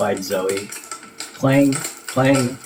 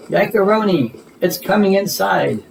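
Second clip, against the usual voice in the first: put-on a child's alarmed yell to her friends in the tent; 7 semitones higher than usual. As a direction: cry out that it's coming in. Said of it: "yelled Zoe".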